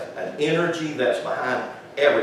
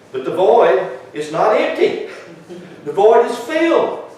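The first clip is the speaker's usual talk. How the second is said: rising preaching emphasis; 10 semitones higher, 7 dB louder than usual. Acted. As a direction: voice climbs high and loud.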